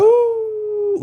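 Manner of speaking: high, long werewolf howl; wolf howl. Pretend. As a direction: throw your head back and howl. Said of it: "Give us a, give us a wolf howl".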